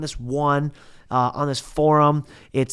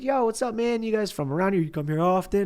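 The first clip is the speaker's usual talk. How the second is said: mock small talk; 6 semitones higher than usual. Imitating their friendly small talk.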